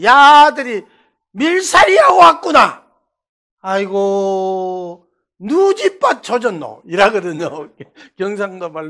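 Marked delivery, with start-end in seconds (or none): 0.0-6.8 an amused Gyeongsang elder, drawing out 아이고; Gyeongsang dialect. Retold or imitated. Bus passenger teasing the boys in Gyeongsang dialect.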